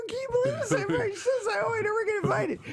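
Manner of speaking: high-pitched, exasperated outburst; mock-quote her in squeaky disbelief; indignant complaint. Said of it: "complained to".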